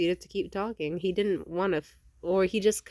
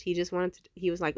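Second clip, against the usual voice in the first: normal talking voice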